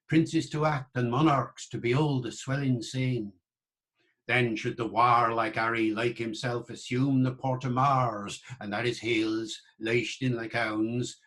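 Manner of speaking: original pronunciation accent with rhotic R, "Harry" without the H; Shakespeare's original pronunciation. Shown as a demonstration. Lecturer recites Henry V chorus in original pronunciation. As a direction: declaim it in early modern English accent, R after vowels curled. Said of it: "in Shakespeare's English".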